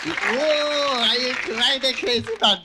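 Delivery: high, fast goofy shouting; wild and crazy guy. Retold or imitated. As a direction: bounce it out like a crazed comic. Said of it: "Steve Martin, comedian".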